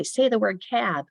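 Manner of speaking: high, slow classroom cue; teacher's lifted drill voice; teacher to class. Shown as a demonstration. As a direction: cue young pupils to repeat a word. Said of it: "So I might say".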